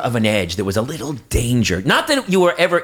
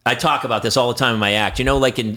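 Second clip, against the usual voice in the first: usual speaking voice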